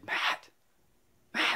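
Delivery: hushed whisper of his name. Retold or imitated. Hiding by the trail, calling his friend in a whisper. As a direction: whisper so the rider won't hear.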